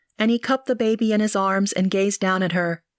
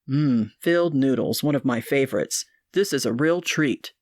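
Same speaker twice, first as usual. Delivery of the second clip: lower, apologetic suitor; Marcos's voice; 6 semitones lower than usual. A narrator's reading. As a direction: drop lower, embarrassed and sincere.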